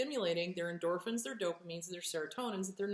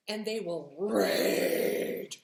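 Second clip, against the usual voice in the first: drawn out, louder and heavy; slow, loud dramatic rage; 6 dB louder, much slower than usual. Acted. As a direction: stretch it out and let it swell. Dramatizing raging.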